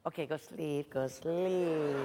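low, hushed coaxing to sleep; soft, deep mock lullaby tone; lulling. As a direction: lull him off softly, voice dropped. Delilah lulling Samson to sleep.

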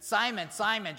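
calling out Simon, Simon, loud and high; attention-getting call. Shown as a demonstration. Jesus calling Simon to get his attention, loud. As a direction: snap Simon, Simon out to grab attention. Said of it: "just to get his attention".

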